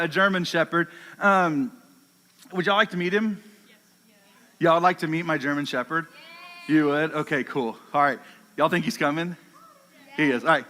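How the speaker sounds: ordinary talk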